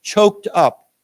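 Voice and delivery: teacher leading a repeat drill; model for repeating. Shown as a demonstration. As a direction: say it out for the class to copy. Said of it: "why don't we say it together".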